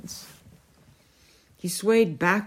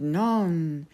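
ordinary delivery

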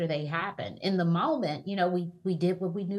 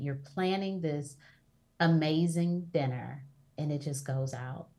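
usual speaking voice

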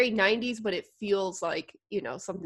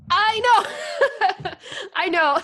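high, loud "I know" through laughter; excited, laughing outburst; laughing exclamation; 11 semitones higher, 8 dB louder, much slower than usual. Real, not acted. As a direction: burst out laughing as you agree. Excited agreement amid laughter.

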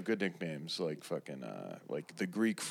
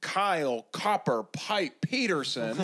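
swooping, higher ballpark introduction; mock baseball announcer; 6 semitones higher than usual. Introducing a made-up pitcher, mock announcer voice. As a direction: present the pitcher with showy cadence.

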